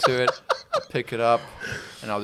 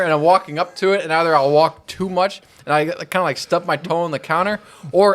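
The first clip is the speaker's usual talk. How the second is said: ordinary talk, nothing marked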